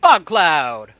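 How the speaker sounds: loud, high casting call; spell shout. Acted. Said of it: "I'm just gonna be like".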